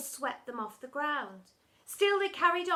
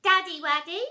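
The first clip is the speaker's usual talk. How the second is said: put-on higher, louder sugary baby talk; higher soppy son voice; babyish son; 6 semitones higher, 10 dB louder than usual. A narrator's reading. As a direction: call to daddy in a cute voice.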